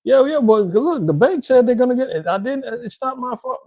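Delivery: high, stammering excuses; frantic protest. Acted. Car owner blaming the bank to repo man, frantic protest. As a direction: argue back in a high, panicky voice.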